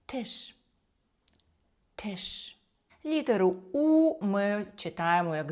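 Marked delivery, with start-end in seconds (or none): 0.1-2.6 Тиш with a puff of air after the T; German Tisch with aspirated onset. Shown as a demonstration. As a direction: release the T of Тиш breathily, softly. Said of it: "з придиханням".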